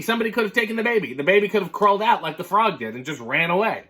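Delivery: usual speaking voice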